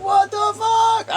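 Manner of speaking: high, slow shocked yell; jolted awake and yelling in shock; startled shout. Retold or imitated. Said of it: "I probably would've woke up like".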